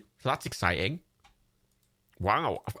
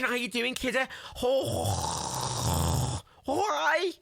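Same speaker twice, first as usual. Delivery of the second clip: high-pitched, exaggerated Scouse with raspy throat sounds; squeaky full-on Scouse; proper Scouse; 11 semitones higher, much slower than usual. Shown as a demonstration. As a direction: lay the Liverpool accent on thick. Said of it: "I could go with the proper fucking".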